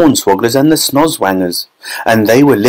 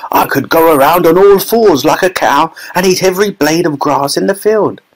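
delighted grandpa, pitched up high; grinning delight; 6 semitones higher than usual. A narrator's reading. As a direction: say it through a happy grin. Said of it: "grinning with delight".

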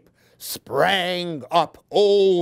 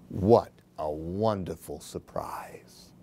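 lower, softer whisper of amazement; whispered wonder; 10 semitones lower, 6 dB quieter than usual. Acted. Reader whispering the duck's happy surprise. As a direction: whisper it like a secret delight.

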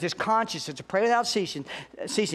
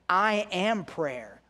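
"I am prayer" very slow, stress on "am"; slow, weighted "I am prayer"; emphatic, slow; much slower than usual. Shown as a demonstration. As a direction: let "am" land, then let it sink in. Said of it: "It's very emphatic".

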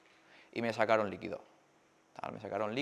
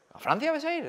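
mock the doctor's disbelief; surprised doctor. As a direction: pitch leaps much higher on the question. (médico incrédulo, mucho más agudo)

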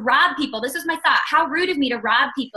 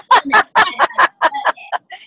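loud amused laugh, pitched up; real burst of laughter; 4 semitones higher, 7 dB louder than usual. Real, not acted. Laughing at husband's joke.

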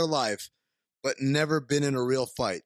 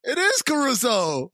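excited; 11 semitones higher, 4 dB louder than usual. Real, not acted. Recognizing the actor, delighted.

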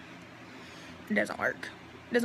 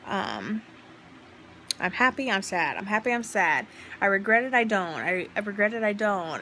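ordinary talk

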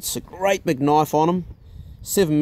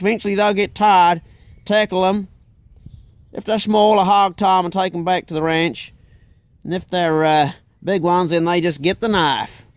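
put-on higher-pitched boastful Texan accent; pitched-up Texan accent, swaggering; 4 semitones higher than usual. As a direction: brag like a Texas hog hunter, Texan accent. Imitating the American, Chuck.

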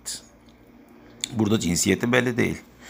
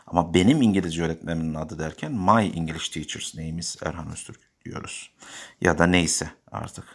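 ordinary talk

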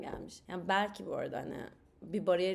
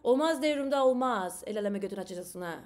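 a much louder, fast, hammed-up toxic-husband scolding; 5 dB louder than usual. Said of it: "toksik maskülen nitesiyle". (kıskanç Temel karısını bağırarak azarlıyor)

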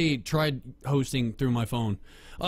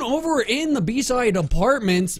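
esports play-by-play with swooping emphasis; sports announcer; 4 semitones higher, 3 dB louder than usual. Pretend. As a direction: call the round like a sportscaster. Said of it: "tell me you're a sports announcer".

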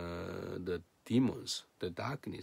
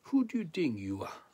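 higher-pitched, confrontational question; mock indignant retort, pitched up; acted challenge; 6 semitones higher, 3 dB louder than usual. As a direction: challenge him as his opponents would. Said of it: "They challenge. They're against Jesus".

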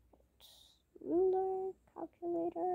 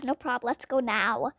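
usual speaking voice

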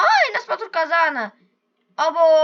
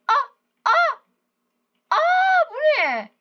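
much higher, loud shocked shout; startled shout; 11 semitones higher, 4 dB louder, much slower than usual. Real, not acted. Gamer startled by something in the game, shouting. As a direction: yell out in alarm at the screen.

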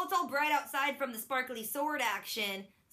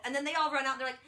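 ordinary talk, nothing marked

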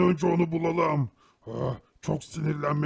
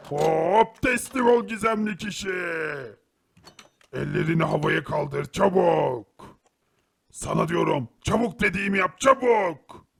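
commanding shout; 2 semitones higher, 4 dB louder than usual. Acted. Herobrine ordering the mysterious man to surrender, shouting. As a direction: force him to surrender.

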